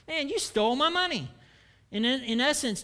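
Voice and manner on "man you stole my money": mock victim. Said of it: "And thieves".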